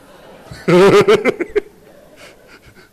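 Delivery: a big burst of laughter, high and loud. Real, not acted. Preacher laughing at his own joke, loud laugh.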